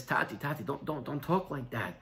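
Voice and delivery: soft, low pleading with a father; hushed protest. Acted. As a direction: gently hush him, voice dropped well down. Son hushes father's talk of death.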